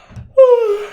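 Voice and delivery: loud sleepy yawn; big yawn. A narrator's reading. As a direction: yawn it out like a bear. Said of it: "He yawned".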